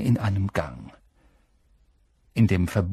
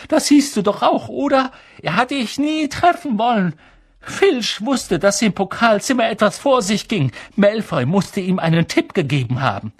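put-on high, pressing girl's voice; agitated insistence; 11 semitones higher, 4 dB louder than usual. A narrator's reading. Hermine convincing Harry they were tricked, agitated. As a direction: argue it urgently, pitched high.

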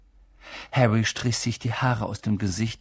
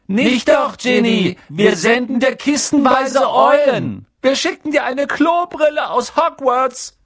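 much higher, louder, cartoonish boy voice ending in a shout; teasing twins; 9 dB louder than usual. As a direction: tease her with a big, silly shout. Twins teasing their crying sister.

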